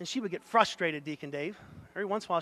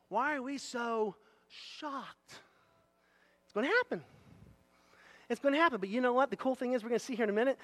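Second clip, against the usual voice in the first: normal talking voice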